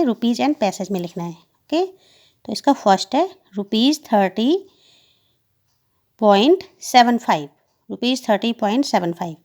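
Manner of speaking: ordinary delivery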